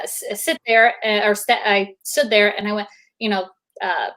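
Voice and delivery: usual speaking voice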